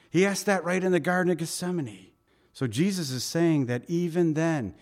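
ordinary talk, nothing marked

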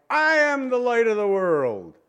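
high, loud declaration; cried out. Acted. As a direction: proclaim it with full force. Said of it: "That's when Jesus cried".

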